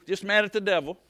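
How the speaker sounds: usual speaking voice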